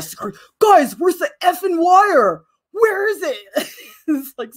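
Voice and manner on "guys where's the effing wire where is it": high, slow mock-furious demand; hammed-up classmate tantrum, loud at first; really mad imitation. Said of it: "he gets really mad".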